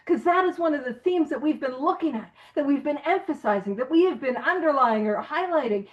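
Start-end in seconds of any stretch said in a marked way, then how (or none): none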